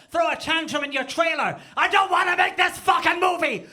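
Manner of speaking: high, loud hammed-up diva shriek; mock-furious high shout; acted tantrum. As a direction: scream it like a star having a tantrum. Mock advert acts a tantrum.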